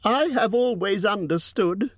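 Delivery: a tiny voice before a dragon; frightened squeak. A narrator's reading. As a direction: hobbit squeaking in fear at the dragon. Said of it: "in a frightened squeak".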